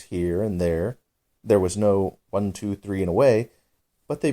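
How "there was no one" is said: usual speaking voice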